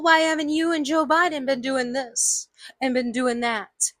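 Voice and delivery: normal talking voice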